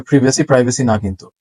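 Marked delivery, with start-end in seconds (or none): 0.0-0.4 British প্রিভেসি with short i, "PRIV-uh-see"; British pronunciation. Shown as a demonstration. Teacher giving the British form, প্রিভেসি with short i. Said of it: "British অ্যাকসেন্টে". 0.4-0.8 American প্রাইভেসি with "eye", "PRY-vuh-see"; American pronunciation. Shown as a demonstration. Teacher contrasting the American form, প্রাইভেসি with pry. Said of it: "American অ্যাকসেন্টে".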